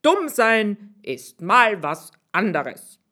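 a tailor delighted with the plan; tailor's voice. Acted. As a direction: savour the silly idea.

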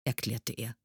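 usual speaking voice

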